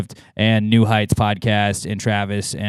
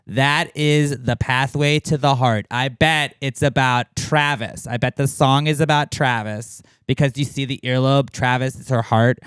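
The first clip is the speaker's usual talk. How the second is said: mock fan theorist; 5 semitones higher than usual. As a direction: tease the fans by over-reading every clue. Parodying a Swiftie analysis.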